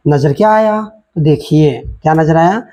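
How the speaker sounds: ordinary talk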